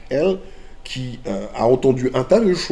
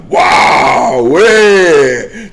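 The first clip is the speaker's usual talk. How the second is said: excited shout; 2 semitones higher, 12 dB louder than usual. Real, not acted. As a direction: whoop with delight.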